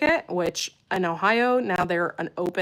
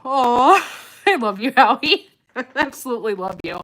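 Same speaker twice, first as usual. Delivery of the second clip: laughing affection; 6 semitones higher, 9 dB louder than usual. Real, not acted. Thanking chat member warmly.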